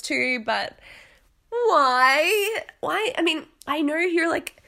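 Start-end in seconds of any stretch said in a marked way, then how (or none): 1.5-3.1 exasperated why. Real, not acted. Exasperated outburst.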